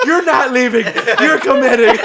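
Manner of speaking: loud, high mock-frantic order; Patrick shouting. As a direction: yell it like a panicked friend refusing retreat. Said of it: "Patrick's like".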